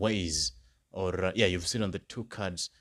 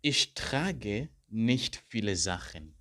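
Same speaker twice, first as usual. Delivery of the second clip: slow, careful German model sentence; slow model answer; slower than usual. Shown as a demonstration. Teacher modelling an exam answer for students, slow and careful. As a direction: spell it out slowly for learners. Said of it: "Then I could say".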